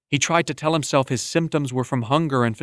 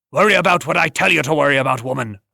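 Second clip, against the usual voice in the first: gruff rebuff; 4 dB louder, faster than usual. Acted. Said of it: "a gruff voice rebuff her".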